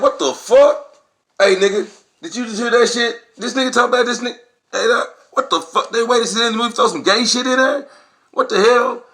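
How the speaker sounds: shocked and outraged. Retold or imitated. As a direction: holler to your friend in disbelief. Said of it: "Of course, we was like".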